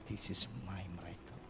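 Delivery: soft unvoiced whisper; hushed confession of faith. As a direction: breathe it like a secret. Quiet reverent aside.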